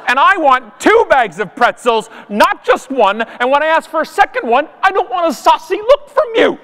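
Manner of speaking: indignant diva rant. Acted. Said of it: "stage sixteen of Canadian anger".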